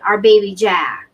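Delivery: ordinary delivery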